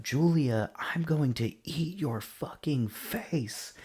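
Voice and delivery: high, slow mock scream, held back in volume; imitated scream. Reviewer mimicking possessed Anise threatening Julia, screaming. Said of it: "she screams out".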